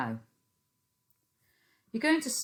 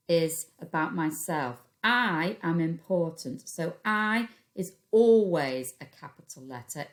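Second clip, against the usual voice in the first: ordinary talk, nothing marked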